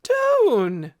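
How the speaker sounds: put-on loud high-pitched child's cry; high, wavery cry. A narrator's reading. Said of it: "cried out, in his high, wavery voice".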